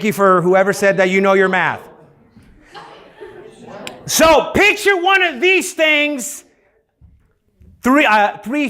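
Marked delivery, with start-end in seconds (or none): 4.1-8.7 excited. Real, not acted. Teacher urging kids to imagine.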